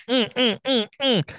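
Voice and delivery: high hummed mock moans; mock pleasure hum. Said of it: "Now you get into bed and you".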